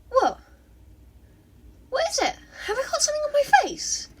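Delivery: high, quick, flustered; puzzled high girl voice; Himiko's voice. A narrator's reading. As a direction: rattle it off fast and high.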